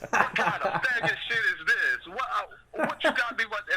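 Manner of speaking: mock-outraged stepdad with laughter underneath; stepdad going off. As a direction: play an indignant dad ranting, amused. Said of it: "he was going off like this".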